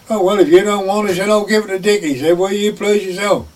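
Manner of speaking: a hint of a sniffy employer brushing it off; a touch of retold pique; mock huffy [name]. [name] mocking the landlord.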